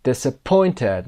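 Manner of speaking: DISAPPOINTED slowly, peaking on POINT; stress on POINT. Shown as a demonstration. Teacher repeats the word, stress on POINT in DISAPPOINTED. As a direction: make POINT stand out in DISAPPOINTED. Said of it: "قسمت پوینت".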